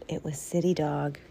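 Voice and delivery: secret storybook whisper. A narrator's reading. Narrator revealing City Dog on the rock, whispered.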